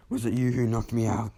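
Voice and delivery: put-on furious girl shouting at the boy; angry demand. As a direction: grab him and demand an answer. Girl confronts Bakugo angrily.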